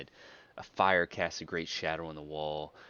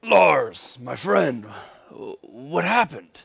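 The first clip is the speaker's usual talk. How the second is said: higher, louder, startled greeting; a leader alarmed to see his friend hurt; pack leader's greeting; 9 semitones higher, 12 dB louder, slower than usual. Acted. Carl greets wounded Lars, loud and alarmed. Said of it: "He's like".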